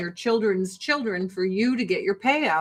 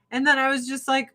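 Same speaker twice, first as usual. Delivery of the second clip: ordinary talk, nothing marked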